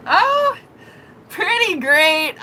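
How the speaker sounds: very high-pitched, drawn-out answer; squeaky retold small talk; acted flustered reply. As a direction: answer him high and slow, acting flustered. Her flustered reply to the guy.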